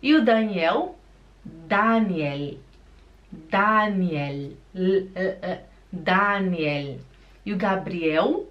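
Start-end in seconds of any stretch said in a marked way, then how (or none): 1.4-4.6 Daniel as DAA-ni-el, stress up front; long first vowel in Daniel, clear final L; German pronunciation. Shown as a demonstration. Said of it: "Aqui no alemão". 4.7-7.0 isolated L, then slow DAA-ni-el for Daniel; clear final L. Shown as a demonstration. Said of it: "O L é no céu da boca".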